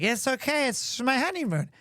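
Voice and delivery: put-on lightly slurred drunk reassurance; tipsy, running the words together; wasted. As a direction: wave off the apology drunkenly. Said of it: "I am completely wasted".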